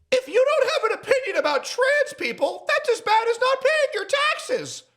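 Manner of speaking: mocking high voice. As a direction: parody the opponent in a silly high voice. Mocking opponent's view.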